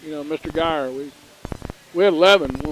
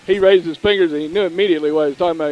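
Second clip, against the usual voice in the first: normal talking voice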